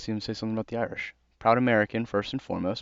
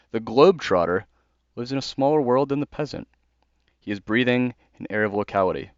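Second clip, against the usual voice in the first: louder, declaiming the quoted passage; formal book reading, pushed louder; reading a passage aloud; 2 semitones higher, 4 dB louder than usual. Acted. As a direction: read it like a proclamation. Reads a Chesterton passage.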